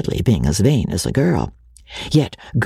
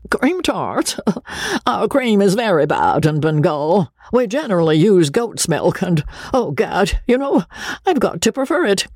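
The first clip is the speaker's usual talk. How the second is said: higher, fussy self-important chatter; pompous Joseph; 7 semitones higher than usual. Acted. Joseph boasting to Rebecca, higher and fussy. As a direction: puff up like a vain colonial dandy.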